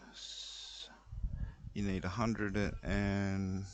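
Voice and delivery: ordinary talk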